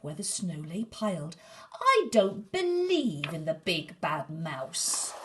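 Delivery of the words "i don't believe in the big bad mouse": louder, slow defiant scoff; a monster child daring the dark. Acted. Gruffalo's child declares the mouse isn't real, slow and loud.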